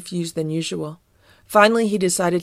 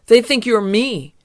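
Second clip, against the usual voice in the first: raised, higher warning voice; urgent shout; 4 semitones higher, 5 dB louder than usual. Acted. Fieldgar warns Garfield of the Cosmots, urgent shout. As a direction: call it out in alarm. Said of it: "Fieldgar shouted".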